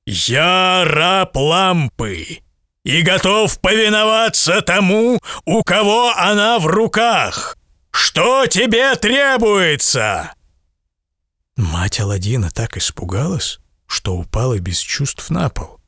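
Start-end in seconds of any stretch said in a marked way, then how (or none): 0.1-10.3 slow, high, booming genie voice; drawn-out spirit of the lamp; thunderous genie. Acted. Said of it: "громовым голосом".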